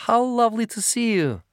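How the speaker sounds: put-on high, gushing Eastern European accent; thick Eastern European accent. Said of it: "in an Eastern European accent as thick".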